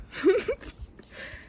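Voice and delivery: sudden high self-conscious giggle; embarrassed laugh. Real, not acted. Laughs at own stumbling.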